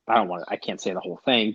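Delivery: ordinary delivery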